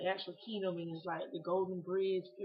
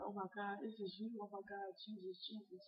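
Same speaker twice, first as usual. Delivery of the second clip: very soft, awestruck murmur; hushed awe; 11 dB quieter than usual. Retold or imitated. Sitting looking at Jesus, hushed awe. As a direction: say it under your breath, overwhelmed, fading softer. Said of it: "I was so in awe".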